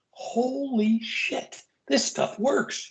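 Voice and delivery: breathy, drawn-out awed gasp of disbelief; stunned disbelief. Retold or imitated. Retelling the moment the grandfather died on cue, stunned and hushed. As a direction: let it fall out of you, slow and amazed. Cop stunned when patient dies instantly.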